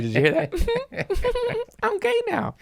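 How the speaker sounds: very high put-on camp voice; campy voice. Joking to his cohost, high campy voice. Mocking his own laugh.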